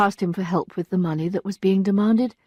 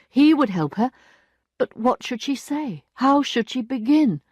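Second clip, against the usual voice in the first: plain reading voice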